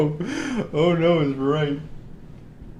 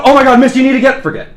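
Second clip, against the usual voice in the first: louder, higher, mock-panicked plea; 9 semitones higher, 12 dB louder, faster than usual. Vampire feigns panic to the woman to make her forget. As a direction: fake fluster while slipping in the command. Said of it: "in a pansy, almost panicked".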